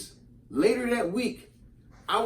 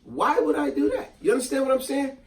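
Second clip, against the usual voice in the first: usual speaking voice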